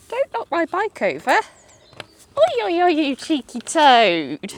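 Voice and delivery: playful scolding. Real, not acted. Piglet nudging the bike.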